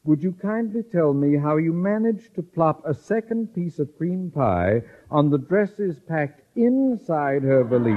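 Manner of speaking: ordinary talk, nothing marked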